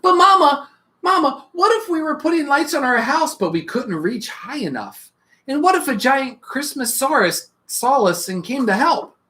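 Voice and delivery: put-on eager little child calling Mama; a child pestering with questions; small child full of what-ifs; curious child.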